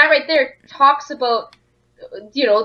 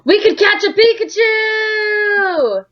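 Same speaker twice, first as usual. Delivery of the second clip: excited shout; 10 semitones higher, much slower than usual. Real, not acted. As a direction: blurt it out thrilled.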